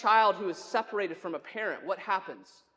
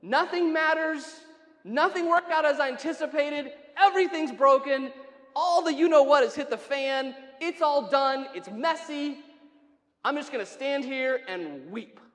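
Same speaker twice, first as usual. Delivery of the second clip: raised, loud outcry of despair, easing at the end; Mary's despair; 3 semitones higher, 4 dB louder than usual. Acted. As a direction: cry out as if everything is lost, then subside. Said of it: "what Mary felt in that moment".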